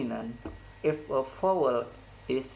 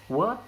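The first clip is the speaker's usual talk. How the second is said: "what" pitched up in a steep rise; high-rise intonation; 6 semitones higher, 4 dB louder than usual. Shown as a demonstration. Teacher showing where the high rise occurs, "what" rising steeply. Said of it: "The high rise intonation occurs in word".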